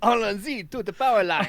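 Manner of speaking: high, loud hammed-up French accent; rally the troops in mock French. French voices riffing on alarm.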